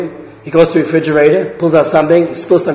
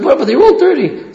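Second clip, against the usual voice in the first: high, stammering disbelief; a woman who can't believe anyone would buy them; surprised apple seller; 11 semitones higher than usual. Retold or imitated. Apple seller protests to buyer.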